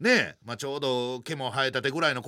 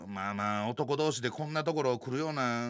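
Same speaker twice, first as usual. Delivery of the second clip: ordinary talk, nothing marked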